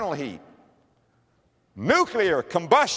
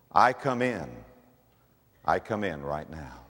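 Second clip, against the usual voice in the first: leading a prayer; 5 semitones lower than usual. Real, not acted. Preacher leading listeners in prayer, sincere and earnest. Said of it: "pray this prayer right now".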